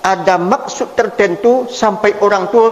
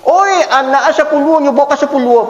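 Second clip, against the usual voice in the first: calling out; 6 semitones higher than usual. Retold or imitated. Guest calls out bringing ten children.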